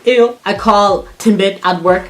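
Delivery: ordinary delivery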